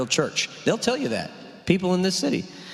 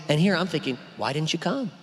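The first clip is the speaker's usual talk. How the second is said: ordinary delivery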